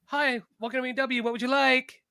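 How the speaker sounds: mock drive-through server chirp; fast-food server. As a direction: switch to polite counter greeting. Sister greeting a drive-through customer.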